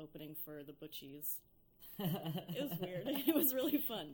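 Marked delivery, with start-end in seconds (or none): 2.0-4.1 laughing through the words, high and loud; giggly, amused recollection. Real, not acted. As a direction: let the laugh break through. Laughing about odd show.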